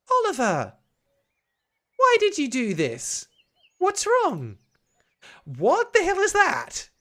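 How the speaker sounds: high, loud, indignant alarm; alarmed scolding. Acted. Babysitter confronting Oliver over the painting, alarmed. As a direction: demand answers, voice jumping up.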